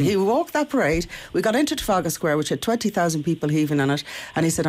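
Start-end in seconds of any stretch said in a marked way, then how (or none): none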